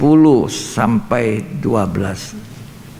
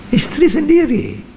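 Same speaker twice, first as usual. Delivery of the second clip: preacher's high emphatic call; high emphatic cry; 12 semitones higher than usual. Acted. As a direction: voice jumps much higher on each phrase. Emphatic preaching, pitch jumps high.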